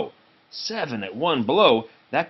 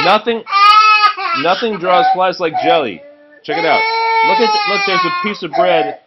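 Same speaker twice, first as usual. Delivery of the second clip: laughing through words; 12 semitones higher, 9 dB louder than usual. Real, not acted. Parent reading while laughing.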